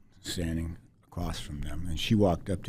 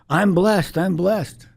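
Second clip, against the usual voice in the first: much higher and louder, a flustered wife giving in; 13 semitones higher, 11 dB louder, slower than usual. Retold or imitated. Wife finally yielding to the insistent stranger, high flustered voice. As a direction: blurt it out to make her stop. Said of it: "my wife goes".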